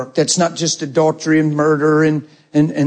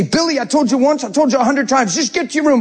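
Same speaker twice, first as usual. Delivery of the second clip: higher-pitched exasperated parent scolding; mock parental frustration, pitched up without shouting; frustrated dad; 10 semitones higher, faster than usual. Dad sending Billy to his room, exasperated. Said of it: "correct them in frustration".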